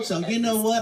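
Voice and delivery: ordinary talk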